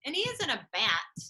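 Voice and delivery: ordinary talk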